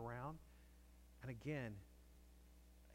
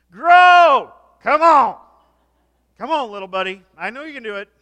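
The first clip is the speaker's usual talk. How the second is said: much higher, very loud coaxing yell; mock cheering shout; 12 semitones higher, 33 dB louder than usual. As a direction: cheer on a seed like a coach. Mock farmer urging seed to grow.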